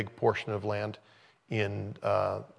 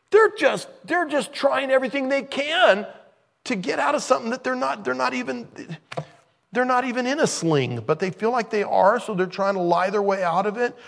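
much higher, fast and insistent; excited preaching; 11 semitones higher, 4 dB louder than usual. Real, not acted. Preacher explains brothers' scheme, high and animated. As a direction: drive the point home, high.

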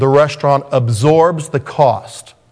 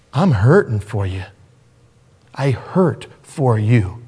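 tender compassion; 4 semitones lower than usual. Acted. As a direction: speak as God grieving for the sinner. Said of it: "and he says".

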